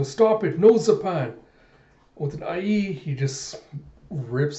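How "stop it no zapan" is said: loud, high pleading cry; desperate shout. Retold or imitated. Sarah shouts at Zapan to stop, loud and high. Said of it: "Sarah tries to go".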